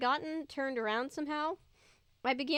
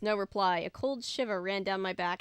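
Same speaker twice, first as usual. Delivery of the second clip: ordinary delivery, nothing marked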